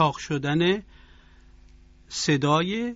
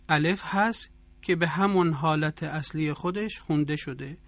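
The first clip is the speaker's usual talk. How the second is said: usual speaking voice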